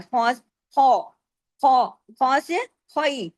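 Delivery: "खौ" thick and rounded from a full mouth; slow heavy "ख्वा", then gliding into "ख्वाई"; heavy kha. Shown as a demonstration. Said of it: "मोटे हरूफ में आता है".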